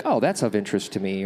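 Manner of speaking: ordinary delivery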